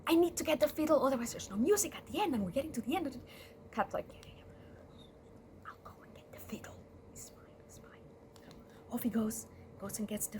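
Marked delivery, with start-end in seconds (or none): 0.1-3.2 urgent, high, rushed outburst; acted fluster, higher and hurried; feigned panic. Rat making excuses to leave church, flustered. 4.1-8.1 very soft, quick whisper; hushed reassurance. Acted. Whispering about fetching the fiddle in church, hushed.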